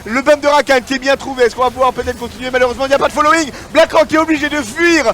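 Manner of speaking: screaming high, loud play-by-play; full hype teamfight call; esports caster. Acted.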